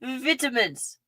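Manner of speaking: a toy British Vitamins; a mild mock British Vitamins; British accent. Shown as a demonstration. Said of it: "The elongated British accent called Texan".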